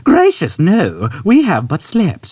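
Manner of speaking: put-on old man. Old man reassuring Arthur, high and gentle. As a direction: brush it off kindly.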